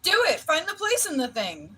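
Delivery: high, playful shouted order; mock urgent command. Co-host playing the vague game prompt, mock urgent command. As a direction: push them along in a hammed-up high yell.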